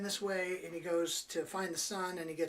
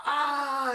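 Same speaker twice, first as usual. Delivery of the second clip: higher, louder mock yelp; startled yelp; 8 semitones higher, 8 dB louder than usual. Clint startled by the rooster, mock yelp. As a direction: yelp as the rooster lands on you. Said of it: "he'd be like".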